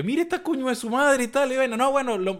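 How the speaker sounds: high, gleeful gossiping voice; mock gossip.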